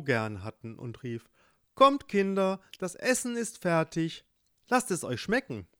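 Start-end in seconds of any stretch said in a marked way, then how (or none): 1.8-5.7 mother calling, higher and louder. Acted. Mother calls her children to dinner, bright call.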